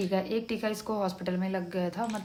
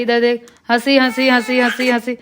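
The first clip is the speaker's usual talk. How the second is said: playful cooing; 4 semitones higher, 16 dB louder than usual. Real, not acted. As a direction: chant it over and over to coax a laugh. Mother cooing to make toddler laugh.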